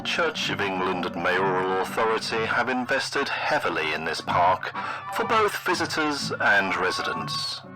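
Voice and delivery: ordinary talk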